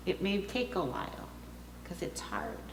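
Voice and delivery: hushed whisper, breath only; soft whispered aside to the congregation. Real, not acted. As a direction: drop to a whisper on the hard part. Tender aside about a hard talk.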